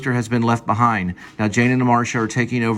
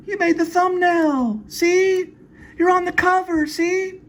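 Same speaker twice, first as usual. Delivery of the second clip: very high falsetto woman voice; Jane's voice; slower than usual. Acted. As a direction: squeak it out high and sweet.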